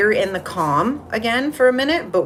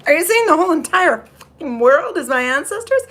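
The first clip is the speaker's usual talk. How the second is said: much higher and louder, laughing in astonishment; laughing disbelief; 12 semitones higher, 7 dB louder, faster than usual. Retold or imitated. Retelling her shock at the vision, laughing, high and loud. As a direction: shriek it out, amazed and cracking up. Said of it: "I was like".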